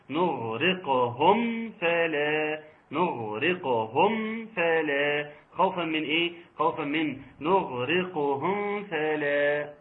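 نغرقهم فلا slow with the meem clearly sounded. Shown as a demonstration. Teacher shows the clear meem before fa. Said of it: "اظهر الميم".

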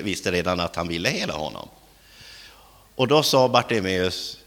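normal talking voice

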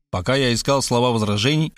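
ordinary delivery

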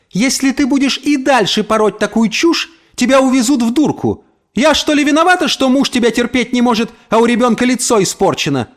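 high-pitched, scornful attack; spiteful retort. A narrator's reading. Neighbour taunts the mother about husband and child, spiteful retort. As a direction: hit back to wound her. Neighbour hits back in a quarrel.

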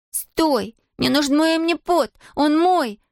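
high-pitched desperate call; girl's urgent cry, not full volume. A narrator's reading. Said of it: "закричала Зия".

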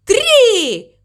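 high, drawn-out shouted count; shouting. A narrator's reading. Mama Mu starts her run, shouting high. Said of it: "прокричала мама Му".

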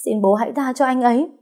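usual speaking voice

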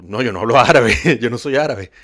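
a loud, laughing denial; retelling her own amused protest, laughing loudly. Retold or imitated. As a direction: laugh it off while insisting, loud.

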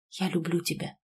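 soft, hushed declaration of love; very quiet, intimate murmur; whispered confession. A narrator's reading. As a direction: say it low into a lover's ear. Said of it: "шепнул он мне на ушко".

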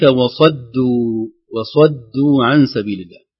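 وصدوا with a clear light د after the heavy ص; slow Tajweed reading of وصدوا; slow careful recitation. Shown as a demonstration. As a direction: stretch out وصدوا, tongue tip for the د. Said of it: "قريب من ذلك".

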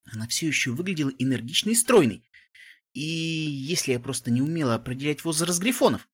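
ordinary delivery

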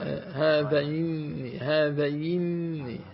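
هذين stretched very slowly to show the vowel; slow pronunciation demo. Shown as a demonstration. Teacher answers student, slowly sounding out هذين. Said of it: "لا، هي ما في مد".

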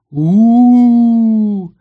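loud, long wailing "oo"; long menacing howl that swells; malicious ghost howl. A narrator's reading. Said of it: "раздалось злобное".

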